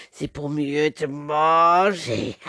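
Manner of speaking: very slow, low, drawn-out wolf; big bad wolf. Wolf answers the girl's question, slow and menacing. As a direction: stretch every word menacingly. Wolf's final line.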